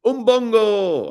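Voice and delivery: a silly Doctor Who catchphrase, slow and loud; catchphrase shout. Acted. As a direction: belt out "Umbongo!" slowly as a catchphrase. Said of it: "Give myself a catchphrase".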